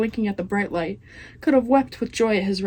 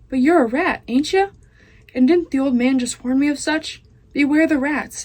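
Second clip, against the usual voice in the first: put-on loud, higher rough country girl voice; loud serving girl; 5 semitones higher, 4 dB louder than usual. Serving girl talks loudly to the rat, loud rough voice. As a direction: bark it out like a half-deaf serving girl.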